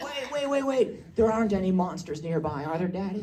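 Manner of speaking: high-pitched child's voice, imitated; worried little daughter. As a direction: imitate a small girl pleading with Daddy. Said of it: "She's like".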